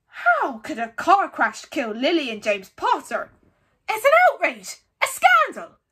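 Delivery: loud, indignant, climbing high; outraged roar. A narrator's reading. Hagrid hearing the car crash lie, outraged. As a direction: thunder it in fury. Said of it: "jumping so angrily".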